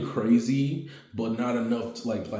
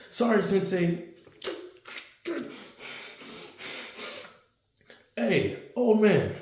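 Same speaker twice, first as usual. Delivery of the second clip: cheeky Deku; 9 semitones higher than usual. Acted. Deku teasing.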